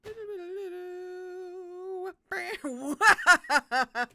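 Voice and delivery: loud, high, hammed-up mocking cackle; mocking laughter. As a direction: cackle at them like a madman. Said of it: "broke into peals of mocking laughter".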